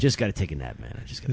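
very low, soft, drowsy imitation. Comic backstage wanting a nap, low and soft. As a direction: murmur it laid-back.

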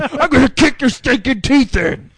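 slow yelled wrestler threat; wrestling promo. Retold or imitated. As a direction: bark it like a pro wrestler cutting a promo. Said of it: "starts cutting a promo like he's a wrestler".

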